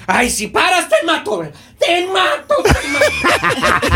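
high hammed-up shrieks, breaking into laughter; mock murderous lover. Woman threatens her lover not to stop, mock screaming. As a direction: stretch the last threat into a comic wail. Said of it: "las asesinas".

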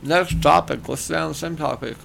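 talking while chewing chips; words around a mouthful of chips; mouth full. Real, not acted. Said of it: "Sorry, I have chips in my mouth".

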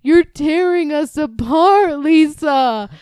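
high, loud, slow mock Tommy Wiseau line; overacted imitation of the main guy. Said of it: "he's like".

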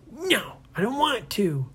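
put-on boy refusing his dad; defiant child. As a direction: refuse stubbornly. Brady defiant.

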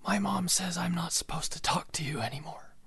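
put-on high kid's stage whisper; loud whisper. Neighbor kid ducking behind the fence, high loud stage whisper. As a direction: whisper it loudly so mom can hear. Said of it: "he's whispering this as loud as humanly possible".

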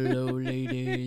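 deep manly voice. Retold or imitated. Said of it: "You sound so deep and manly on this".

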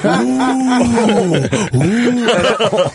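high drawn-out crowd ooh; crowd's "ooh". Retold or imitated. Whole crowd reacts to angry guy's exit, high ooh. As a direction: imitate a whole audience going ooh. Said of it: "the whole crowd goes".

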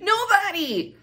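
Nobody! cried out high and loud; exclaiming. Real, not acted. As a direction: shout it in disbelief. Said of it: "outraged exclamation".

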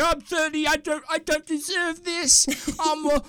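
high, drawn-out mock wailing; upset mock protest. Son being kicked out by parents, hammed-up upset. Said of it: "I'm all upset".

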